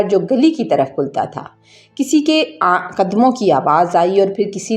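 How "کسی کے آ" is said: ordinary delivery, nothing marked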